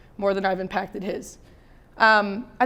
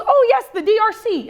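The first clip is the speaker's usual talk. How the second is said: put-on knowing kid; 11 semitones higher, 4 dB louder than usual. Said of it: "He goes".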